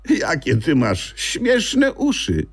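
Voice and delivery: high, slow, amazed exclamation; surprised. Acted. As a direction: marvel at the funny ears. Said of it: "zdziwiła się".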